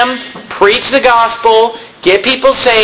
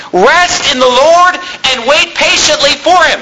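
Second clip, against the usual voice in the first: higher, ringing preacher's reading of the psalm; fervent scripture reading; 5 semitones higher than usual. Acted. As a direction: proclaim the verses to the congregation. Reads Psalm aloud with fervor.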